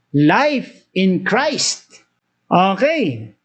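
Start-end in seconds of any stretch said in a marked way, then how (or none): 0.1-2.0 very slow, each word weighed; solemn emphasis. Shown as a demonstration. Preacher answering the congregation, very slow and solemn. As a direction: lay each word down slowly. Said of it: "Sino yung life na yan".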